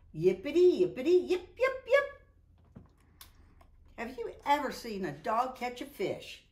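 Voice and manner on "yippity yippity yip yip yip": high excited puppy yapping; yappy dog. Acted. Zippity the stray bounds about, yapping high. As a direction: yip like a bouncy little dog.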